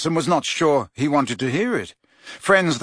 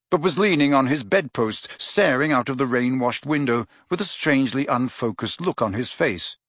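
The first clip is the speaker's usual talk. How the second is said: plain reading voice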